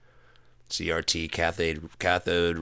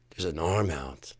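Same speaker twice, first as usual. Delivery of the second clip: normal talking voice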